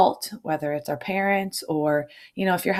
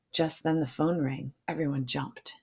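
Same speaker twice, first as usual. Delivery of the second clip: plain reading voice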